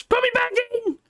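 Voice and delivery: high-pitched mock scream, held on one note; comic retold scream. Man joking about his own birth, mock screaming. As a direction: cry it out high like a protesting newborn. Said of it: "screaming at the top of my voice".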